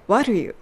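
"what are you" run together as "wha-ru-you"; linked sounds. Shown as a demonstration. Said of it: "are youがwhatのTと連結して".

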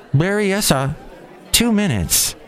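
high, even automated announcement; train announcer voice. Retold or imitated. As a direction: announce the stop like a recorded train voice. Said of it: "the electronic voice woman".

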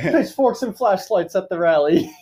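slow, laughing quip; slow giggling joke. Real, not acted. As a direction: chuckle the words out slowly. Joking while laughing.

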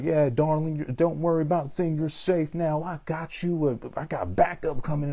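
high, syrupy reassuring officer voice; mock sweet cop talk. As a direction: coo it like a patronizing cop. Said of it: "be like".

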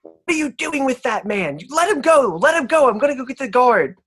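high, loud alarmed demands; barmaid yelling. Acted. Barmaid to Paramar dragging a body, loud and high. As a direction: shout at him to drop the body. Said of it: "starts saying".

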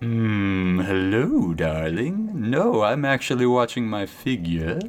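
low, purring diva cow; sultry cow voice. Acted. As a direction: play a vain cow, voice dropped low.

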